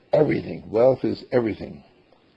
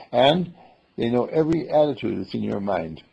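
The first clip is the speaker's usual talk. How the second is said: usual speaking voice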